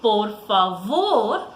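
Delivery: por favor drawn out slowly with a falling close; slow, emphatic por favor. Shown as a demonstration. Teacher slowly modelling por favor for pupils. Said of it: "that magical word".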